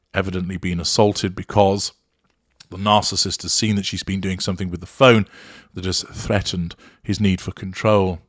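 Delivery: ordinary delivery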